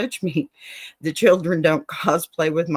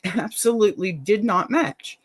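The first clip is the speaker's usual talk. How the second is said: ordinary talk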